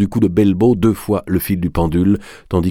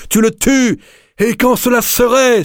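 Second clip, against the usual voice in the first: much higher, defiant angry yell; angry outcry; 3 dB louder than usual. A narrator's reading. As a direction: fling it back in fury. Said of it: "s'écria Aglier".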